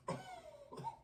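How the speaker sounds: harsh cough from spicy food; coughing fit. Real, not acted. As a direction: coughs on the burning powder. Coughing after eating wasabi.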